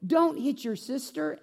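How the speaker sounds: high-pitched exasperated scolding; fed-up mom scolding her fighting kids; angry scolding mom. Retold or imitated. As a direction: snap at the kids like a fed-up mother. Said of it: "she would say things like".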